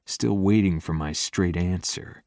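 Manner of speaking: ordinary delivery, nothing marked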